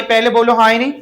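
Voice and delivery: ordinary delivery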